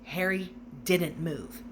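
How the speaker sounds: ordinary delivery, nothing marked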